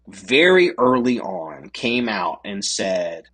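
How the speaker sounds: ordinary talk, nothing marked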